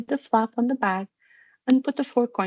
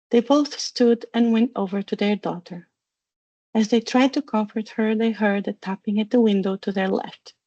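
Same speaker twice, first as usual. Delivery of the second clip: ordinary delivery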